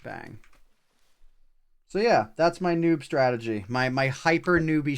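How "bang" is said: ordinary delivery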